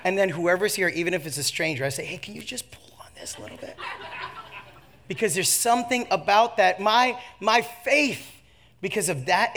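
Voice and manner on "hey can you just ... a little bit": quick, voiceless squeezed request; strained whisper. Retold or imitated. Speaker asking a stranger to pull on the seatbelt, strained whisper. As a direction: whisper it fast as if cinched too tight.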